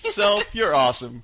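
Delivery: high, loud mock congratulation; mock self-praise. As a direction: praise yourself with fake grandeur.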